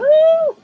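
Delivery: sharp very high loud ooh; a startled yelp of ooh; surprised ooh. Real, not acted. Crafter overshoots the cut, a startled high ooh. Cuts too much paper.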